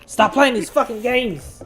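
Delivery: mock yell. Mock yelling at player.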